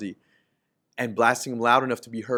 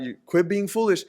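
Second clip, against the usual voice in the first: raised, louder rebuke; calling out; 8 semitones higher, 5 dB louder than usual. Acted. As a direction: call them out, higher and louder. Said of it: "She's calling people out".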